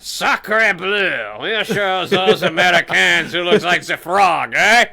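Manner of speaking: loud hammed-up French accent; french chef. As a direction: shout it like a cartoon Frenchman. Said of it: "mock Frenchman after French menu".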